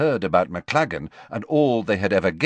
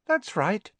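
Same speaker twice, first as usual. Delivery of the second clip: high, sugary sweet reply; 12 semitones higher than usual. A narrator's reading. Hermione confirming her date to Parvati, sweetly. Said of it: "said Hermione sweetly".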